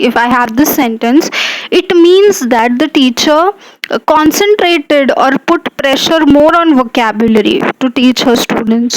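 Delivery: ordinary talk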